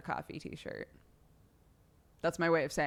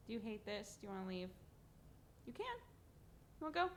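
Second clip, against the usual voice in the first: soft talk to cat; 3 semitones higher, 18 dB quieter, faster than usual. Real, not acted. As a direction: gently coax the cat.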